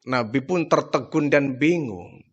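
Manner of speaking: ordinary talk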